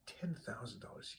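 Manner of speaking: breathless whispered disbelief; a gambler whispering over his winnings; awed whisper. Acted. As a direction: hush it like you can't believe the win. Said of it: "He's ecstatic".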